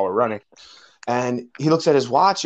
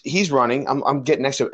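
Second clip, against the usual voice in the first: ordinary delivery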